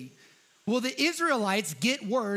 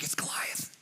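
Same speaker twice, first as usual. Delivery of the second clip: hushed, breathy warning; fearful whisper; 8 dB quieter than usual. Retold or imitated. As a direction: whisper the name as if he might hear. Soldiers name the feared giant.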